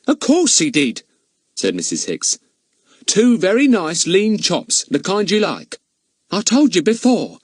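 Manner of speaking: high, chatty housekeeper protesting. Acted. Charwoman contradicting the village policeman, high and eager. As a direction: pitch her up like a bustling charwoman.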